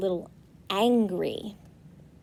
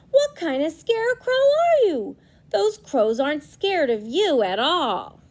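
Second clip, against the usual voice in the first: high, loud scolding farmer; angry farmer; 6 semitones higher, 6 dB louder than usual. Acted. Farmer scolding scarecrow for failing, high and loud. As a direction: tell the scarecrow off.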